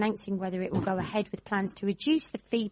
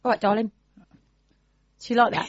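put-on Cornish accent; 5 dB louder, much faster than usual. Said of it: "Said with a Cornish accent".

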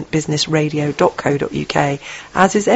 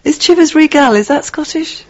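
laughing; 10 semitones higher than usual. Real, not acted. Laughing question about the whisky.

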